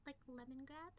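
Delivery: usual speaking voice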